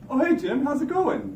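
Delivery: high, bright surprised hello; cheerful greeting. Retold or imitated. Voice behind him greets the officer, high cheerful hello. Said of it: "I hear a voice".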